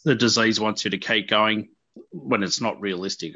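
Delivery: ordinary talk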